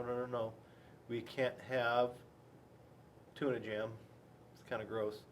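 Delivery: usual speaking voice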